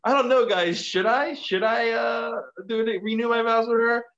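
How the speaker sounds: high-pitched douchey crowd-teasing; douchey showman. Retold or imitated. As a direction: play the douche polling the audience, voice way up. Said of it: "He's a douche".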